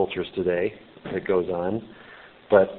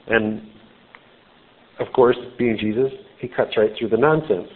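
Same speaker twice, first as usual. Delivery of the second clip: usual speaking voice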